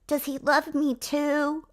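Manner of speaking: put-on high, slow little-kid voice; childlike voice. Heroine asking her lover, in a high, slow little-kid voice. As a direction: ask it like a small child. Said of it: "I don't know why she's a kid".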